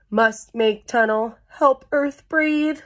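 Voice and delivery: measured, punchy little worm chant; worm thinking aloud. Acted. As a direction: voice the worm's thought word by word, stretching the end. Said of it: "That's what he was thinking".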